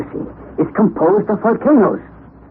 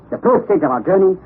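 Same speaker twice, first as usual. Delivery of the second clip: ordinary talk, nothing marked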